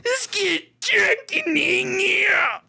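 high, slow, laughing shout; mocking cartoon frontman. Friend mocking the sign-off, cartoonish slow shout. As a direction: drag it out like a cartoon character. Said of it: "you sound like a South Park character".

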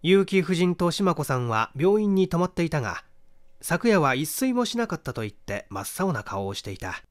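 plain reading voice

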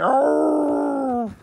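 long, high drawn-out howl; mock howl. Howling over a wrong lyric, mock howl. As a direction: howl it out in comic despair. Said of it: "I'm gonna howl".

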